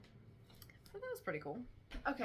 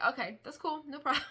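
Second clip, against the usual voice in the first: higher, brighter and louder, easygoing; cheerful forgiving; 7 semitones higher, 5 dB louder than usual. Retold or imitated. Forgiving the apologizing man, higher and cheerful. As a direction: brush it off with a cheery lilt. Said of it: "I'm like".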